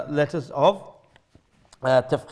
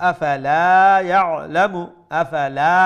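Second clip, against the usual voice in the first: louder, chanted word by word; recitation; 8 dB louder than usual. Shown as a demonstration. Teacher reciting each word aloud to the class. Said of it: "teacher recites word by word".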